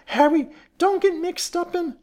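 loud, very high shrill wife. A narrator's reading. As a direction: shriek a shrill warning to your husband. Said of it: "shrilled Missus Potter".

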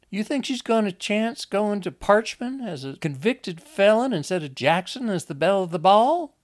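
very high, loud, agitated voice; frantic Lizzie. Acted. Lizzie warns Enoch that Wanda is crazy, high, loud and frantic. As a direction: argue for your life at the top of your range.

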